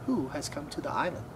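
plain reading voice

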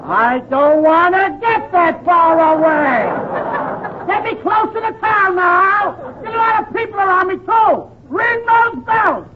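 panicked comic shouting. Acted. Nervous patient refusing a lonely sanitarium, panicked shouting. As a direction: holler for people and noise around you.

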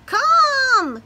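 tiny high glowworm voice; squeaked. Acted. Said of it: "squeaked the glowworm".

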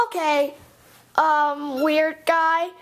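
normal talking voice

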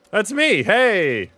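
high, loud burst of recognition; excited high-pitched shout; excited cry. Real, not acted. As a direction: call it out high and loud, delighted. Spots self in the game.

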